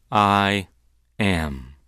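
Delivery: "I am" split by a pause; solemn, deliberate "I am", falling away at the end; weighty emphasis. Shown as a demonstration. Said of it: "it's better to translate it".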